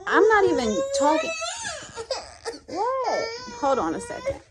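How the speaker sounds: breaking into high laughter mid-sentence; cracking up, can't get the words out; laughing. Real, not acted. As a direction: lose it laughing and stop the thought.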